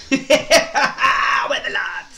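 loud, high shout through laughter; laughing shout. Real, not acted. Co-host answers the TikTok jibe, laughing shout.

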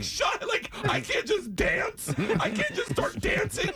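high-pitched mock protest, shouted; high excited squeal of protest. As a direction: squeal it like an overexcited kid. Mock protest, voiced self as kid.

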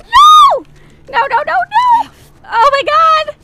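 very high, very loud panicked yells; shrieked game panic; panicked screaming. Real, not acted. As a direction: scream it in real alarm. Panic during game attack.

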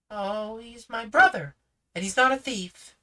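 put-on high, fast, eager girl's voice; a confident girl speaking up; young girl Judy. Judy defends her brother to the policeman, high and eager. Judy's lines.

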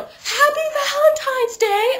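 high, loud, drawn-out holler; mock ghostly yell. Dead teenage boys about to crush you, hammed-up yell. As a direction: yell the greeting in a stretched, put-on voice.

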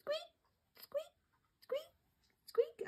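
tiny very high soft squeaks; mouse squeaks. Shown as a demonstration. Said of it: "Mice squeak".

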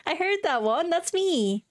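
high, thrilled exclaim; excited. Real, not acted. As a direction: call out with glee. Excited reaction.

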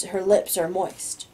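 plain reading voice

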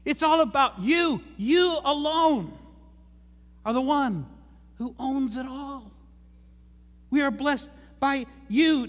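high, ringing prayer reading; prayerful reading. Acted. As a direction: proclaim the prayer up high with awe. Reads David's prayer aloud.

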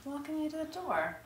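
very soft, secretive murmur; hushed. Acted. Woman walking lover to the door, hushed. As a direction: speak softly so the house stays asleep. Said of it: "Why are we whispering and sneaking around?".

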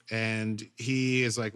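usual speaking voice

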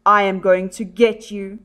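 normal talking voice

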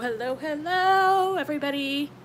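sing-song hello. Real, not acted. Greeting her live chat in a loud, high sing-song. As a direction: stretch it up high like a happy call.

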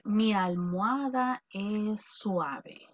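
slow, careful example sentence; slow model sentence. Shown as a demonstration. Teacher slowly giving a sentence to translate. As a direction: read it out slowly as a prompt. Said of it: "para decir".

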